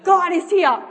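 high, booming acted announcement; excited proclamation. Kid proclaiming in the shop, excited proclamation. Preacher repeats kid's proclamation.

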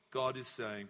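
normal talking voice

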